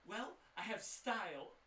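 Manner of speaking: high, loud, theatrical shopkeeper; sassy shopkeeper. Acted. Shopkeeper scoffs at the party for treating him like an NPC, high and loud. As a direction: show off, then mock the idea of being a simple merchant.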